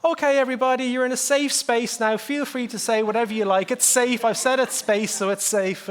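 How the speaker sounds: sarcastic sugary "it's safe" facilitator voice; mock facilitator. Speaker mocking empty workshop reassurance to the audience, high and sing-song. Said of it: "This isn't just about saying".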